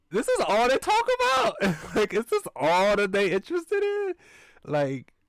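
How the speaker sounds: very high, loud disbelieving squeal; shocked squeal. Real, not acted. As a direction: shriek it in outraged disbelief. Shocked squealing reaction to character.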